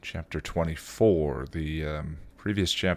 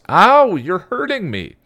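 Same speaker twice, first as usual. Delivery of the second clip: high, loud, drawn-out complaint; acted teenage yelp of protest; pained whine; 13 semitones higher, 10 dB louder than usual. A narrator's reading. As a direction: wail it like a hurt kid sister. Girl complains of pain in story.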